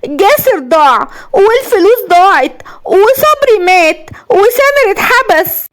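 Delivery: high, slow lament, close to hysteria; high-pitched deranged woman counting disasters; unhinged villainess. A narrator's reading. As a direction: draw each loss out in a high, crazed wail. Villainess ranting over her losses.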